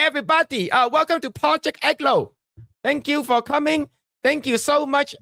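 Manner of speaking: high, bright welcome to viewers; hyped streamer intro. Acted. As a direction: pump up the stream opening. Upbeat stream welcome and thanks.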